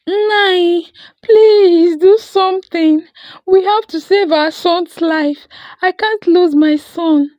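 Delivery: high, loud begging; mother begs her husband to save their son; desperate plea. A narrator's reading. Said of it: "Ndidi cried".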